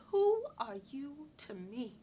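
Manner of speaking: slow, measured challenge with a long WHO; restrained challenge. Acted. Woman thinking back at a controlling man, restrained challenge. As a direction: question him slowly, at a contained volume. Acted retort, first time.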